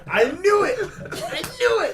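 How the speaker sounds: high laughing gloat; laughing triumph. Real, not acted.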